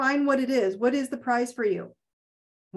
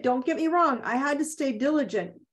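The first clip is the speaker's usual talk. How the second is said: normal talking voice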